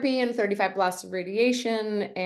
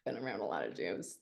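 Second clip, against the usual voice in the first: very soft low echo; muttered echo; 13 semitones lower, 13 dB quieter than usual. Real, not acted. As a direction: mutter it back. Echoing line under her breath.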